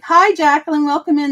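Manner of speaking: a mild impression of a chirpy vlogger greeting; Southern Belle greeting. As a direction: greet a viewer by name like a live streamer. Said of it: "she always talks like that".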